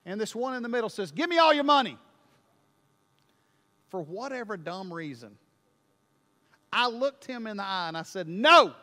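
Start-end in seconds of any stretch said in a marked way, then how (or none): 1.1-2.0 loud, higher mugger's demand; gruff demand. Retold or imitated. Mugger in the park demanding cash, loud. 8.3-8.7 sudden loud flat refusal; defiant no. Retold or imitated. Preacher refusing the mugger, loud defiant no.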